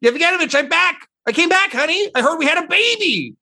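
very high, loud, sing-song voice for a returning husband; strange new voice from her mouth. Acted. A husband's voice through her mouth, coming back to his wife and baby, very high. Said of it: "you just hear".

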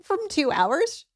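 incredulous parent. Acted. Parent pressing child to commit to dinner, exasperated. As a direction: lay out the obvious, rising into incredulity.

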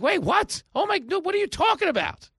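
very high bewildered protest; high mock confusion; stunned bystander. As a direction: sputter in disbelief. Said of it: "And you're like".

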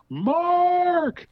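high, drawn-out excited scream of a name; retold scream. Old flame spots him at the bar, screaming his name. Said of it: "I hear her scream".

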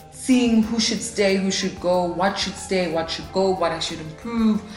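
ordinary talk, nothing marked

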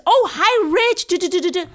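high, loud gushing hello; fawning greeting. Retold or imitated. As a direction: gush over a famous comic like a starstruck fan. Fans fawning over Rich at club.

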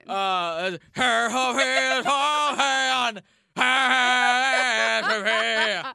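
high, loud grunge wail; [name]. Shown as a demonstration. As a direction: belt it like a grunge singer. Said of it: "I can do a pretty good [name]".

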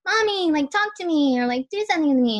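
put-on high childlike plea to mommy; little girl pleading. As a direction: beg like a small neglected girl. Voices herself as little girl.

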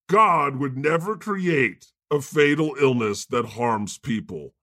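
ordinary talk

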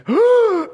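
loud, high, horrified intake of breath; shocked gasp. Acted. Woman behind sees him at the exit door, shocked gasp. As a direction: gasp sharply as if witnessing disaster.

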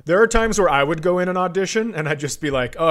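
ordinary delivery

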